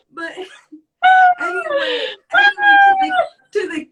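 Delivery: high, loud giggles breaking up the words; laughing so hard the pitch shoots up; real laughing fit. Real, not acted. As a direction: talk through uncontrollable laughter. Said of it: "I'm laughing now".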